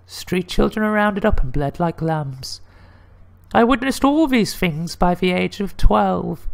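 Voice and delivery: high falsetto lady telling a grim tale; high-pitched put-on woman's voice. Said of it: "I get to do the lady voice".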